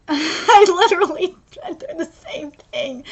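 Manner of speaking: slow words broken by sobbing laughs; real crying. Real, not acted. Reader relates to the author's experience, crying. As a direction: struggle slowly through the tears. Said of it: "it's really making me cry".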